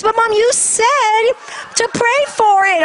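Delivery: put-on high-pitched protesting kid; imitated child's high, insistent whine; pleading child. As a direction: plead your case to mom. Child pleads to keep turtle.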